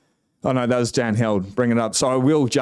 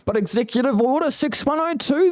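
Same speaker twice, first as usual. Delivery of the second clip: squeaky mock voice parroting critics, much higher; 13 semitones higher than usual. Host mocking Bitcoin doubters in a squeaky high parody voice. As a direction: sneer it up high like a naysayer.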